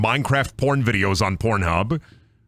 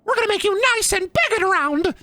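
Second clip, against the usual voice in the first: very high, squeaky cartoon villain; mock apology in a shrunken squeak; Bendy. Cartoon villain answering pleading victim, squeaky taunt. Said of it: "please, Bendy".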